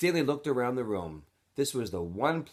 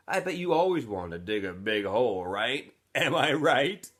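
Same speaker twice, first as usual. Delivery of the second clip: teasing laugh; 4 semitones higher, 6 dB louder than usual. Acted. X-Ray teasing Stanley.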